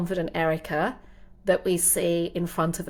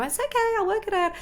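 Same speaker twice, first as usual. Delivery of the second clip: breezy reassurance; 12 semitones higher, faster than usual. Retold or imitated. Breezy reply to doubting husband.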